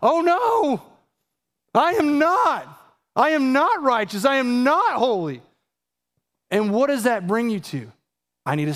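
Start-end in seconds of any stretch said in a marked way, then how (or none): 0.0-5.4 dawning dismay. Retold or imitated. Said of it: "you start to realize".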